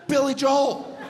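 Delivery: the singer saying his own name, loudly. Acted. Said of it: "you know who pronounces it [name]?".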